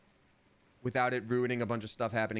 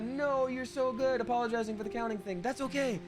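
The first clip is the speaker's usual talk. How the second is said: high, sweet reassuring voice; reading chat in a high voice; 10 semitones higher than usual. Retold or imitated. Streamer reading a viewer's kind message, high and sweet. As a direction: read the message pitched way up and gushing.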